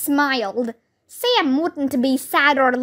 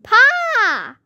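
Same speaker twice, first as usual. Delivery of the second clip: high, loud cry of Pa; a girl calling out to her father across the clearing; shouted; 9 semitones higher, 4 dB louder than usual. A narrator's reading. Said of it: "someone shouted".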